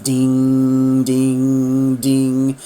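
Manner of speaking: lightly imitated chime, held tones; clock chimes. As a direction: ring out like a clock striking. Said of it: "you can hear the chimes going off".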